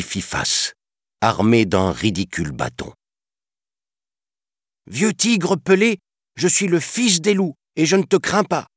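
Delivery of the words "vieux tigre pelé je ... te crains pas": put-on high, defiant child voice; brave boy. Mowgli defying the tiger, high brave child voice. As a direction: stand up to him bravely. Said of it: "rétorqua courageusement le petit homme".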